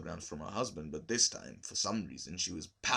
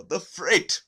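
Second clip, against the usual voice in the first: higher, louder exclamation of dismay; cry of horror; 8 semitones higher, 9 dB louder than usual. A narrator's reading. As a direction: throw up hands in horror at the freight. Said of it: "she cried covering her cheeks in horror".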